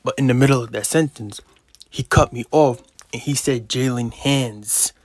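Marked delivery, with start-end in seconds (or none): 3.7-4.9 Jalen Hands with the final S stretched long and slow. Retold or imitated. Imitating the kid dragging the S in Hands at me. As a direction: drag out the S in Hands like hissing spit. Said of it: "He stretched out the S so more spit could fly".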